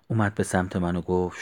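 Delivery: usual speaking voice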